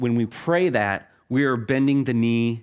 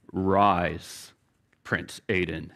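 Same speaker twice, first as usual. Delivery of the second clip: a lightly voiced king, pitched lower and grave; slow, lower, kingly command to rise; deep king voice; 5 semitones lower, much slower than usual. King in a bedtime story bidding his kneeling son rise, lower kingly voice. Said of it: "It was in a deep voice like that".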